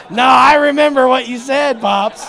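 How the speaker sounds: loud, laughing jab at his dad; laughing tease. Real, not acted. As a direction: laugh as you call him out on it.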